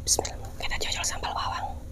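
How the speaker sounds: soft unvoiced whisper. Real, not acted. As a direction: whisper it close to the mic. Whispering while eating on camera.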